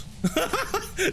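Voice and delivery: short high burst of laughter; quick high giggle. Real, not acted. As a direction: crack up briefly, high-pitched. Cracks up at mispronunciation.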